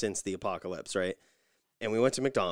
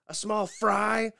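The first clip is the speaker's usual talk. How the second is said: much higher, slow drawn-out question; mock incredulous clerk; 13 semitones higher, 6 dB louder, much slower than usual. Cashier questions the fry order, mock-high and slow. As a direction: stretch it out in disbelief. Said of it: "She's like".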